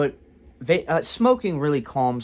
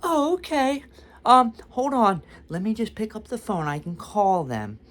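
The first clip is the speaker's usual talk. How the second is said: high, sweet receptionist impersonation; high-pitched, helpful mock sweetness; so nice; 10 semitones higher than usual. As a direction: imitate an overly nice desk lady in a high voice. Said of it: "she was so nice".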